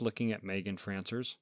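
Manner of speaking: ordinary delivery, nothing marked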